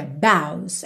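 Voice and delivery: boughs said as bows, rhyming with cows; boughs pronunciation. Shown as a demonstration. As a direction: say boughs like bowz. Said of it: "essa pronúncia".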